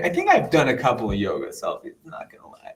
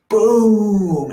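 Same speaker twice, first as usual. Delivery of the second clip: sudden loud, higher boom like an explosion; sudden loud boom as the cosmic snake strikes; emphatic boom; 11 semitones higher, 8 dB louder than usual. Retold or imitated. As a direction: punch it out as an explosion. Snake blasting through his eyes.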